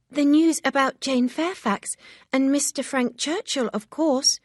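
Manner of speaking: can't hold the news in; cried out. A narrator's reading. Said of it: "Harriet cried".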